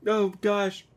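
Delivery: high-pitched, mock-dismayed exclamation; mock distressed cry. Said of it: "and he's like".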